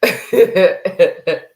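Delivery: loud, helpless laugh at the comment; real burst of laughter. Real, not acted. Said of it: "The only reason why I'm laughing".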